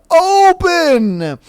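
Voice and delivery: high, stretched booming command; booming yell. Acted. Voice from above orders treasure chest opened, booming yell. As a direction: call it out from the heavens. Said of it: "The booming voice immediately yells".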